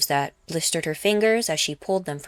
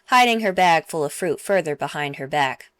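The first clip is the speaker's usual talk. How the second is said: ordinary delivery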